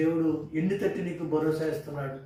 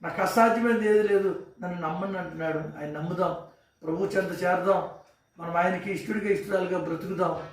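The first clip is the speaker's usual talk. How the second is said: loud, higher, fervent preaching; impassioned pulpit preaching, louder and higher; preaching at full force; 3 semitones higher, 3 dB louder than usual. Acted. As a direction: build it into a rousing call to trust God. Sermon rises to full preaching.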